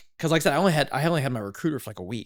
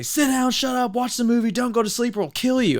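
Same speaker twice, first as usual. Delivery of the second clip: higher, louder barked orders in a put-on drill sergeant voice; mock drill instructor; 11 semitones higher, 5 dB louder than usual. Retelling the bus ride to boot camp, a mock drill instructor barking rules. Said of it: "and they say".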